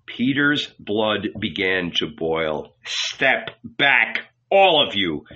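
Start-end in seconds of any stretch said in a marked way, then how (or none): 2.8-5.3 mildly forceful, angry order; shouted order. A narrator's reading. Peter's blood boiling, mildly shouted order. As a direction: push them back with restrained force. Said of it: "he shouted".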